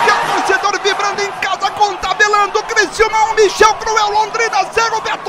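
very high-pitched commentator cry; excited score announcement. Real, not acted. Commentator announcing the new score to fans at home, high and thrilled. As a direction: proclaim the score at full excitement.

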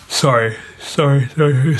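talking through a yawn; words stretched by a yawn. Real, not acted. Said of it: "sorry for yawning".

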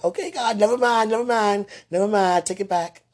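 high loud frantic pleading; scared driver begging God, high and loud; panicked backing out. Retold or imitated. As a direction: plead in a panic to take it back. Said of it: "person got scared, was like".

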